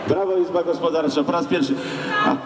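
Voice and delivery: ordinary talk, nothing marked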